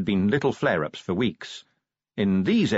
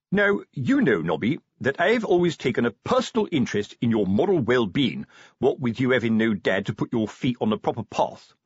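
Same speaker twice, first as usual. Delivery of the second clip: pompous sergeant giving moral guidance; fatherly sergeant; 3 semitones higher than usual. Acted. Sergeant advising fatherless corporal, fatherly and pompous. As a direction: lecture him like a self-appointed father.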